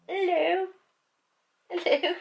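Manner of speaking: very high, squeaky little hello; loud, high-pitched coo to a pet; pet greeting. Real, not acted. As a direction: greet your dog in a tiny high voice.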